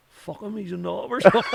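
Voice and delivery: high, loud laughing retort; mock dismissive brother, laughing; laughing quote. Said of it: "And he goes".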